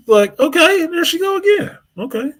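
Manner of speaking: high teasing there she go again; mock excited. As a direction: pitch way up, hammed-up surprise. Mock excited at her return.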